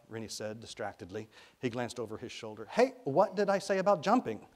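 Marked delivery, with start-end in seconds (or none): 2.7-4.5 raised, higher-pitched telling-off; scolding. A narrator's reading. Rennie to Ty jumping up and down, scolding. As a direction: call out sharply to stop a child.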